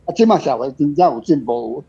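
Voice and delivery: normal talking voice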